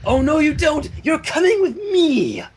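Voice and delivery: high, firm, slow; pony refusing to let go; determined Rarity. A narrator's reading. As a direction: grab her with a playful threat. Rarity grabs Rainbow Dash.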